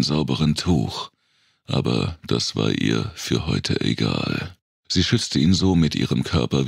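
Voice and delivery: ordinary delivery, nothing marked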